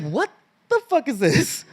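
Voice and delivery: high-pitched incredulous reaction; bewildered. Retold or imitated. Brother stunned by the warm welcome, bewildered. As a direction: ask it high, in comic disbelief.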